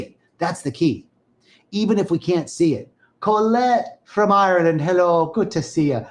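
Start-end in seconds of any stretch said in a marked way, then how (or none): 3.2-6.0 greeting in a lightly put-on, bad Irish accent; a playful stab at an Irish lilt. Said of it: "that's my Irish accent. It's really bad".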